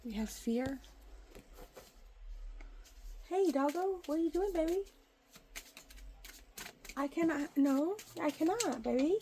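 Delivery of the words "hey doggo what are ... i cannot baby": higher pet-talk voice. Real, not acted. Cooing to her dog.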